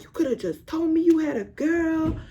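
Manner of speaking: high whiny imitation of the other girl; mocking the upset girl. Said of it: "She was like".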